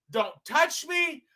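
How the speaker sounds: ordinary talk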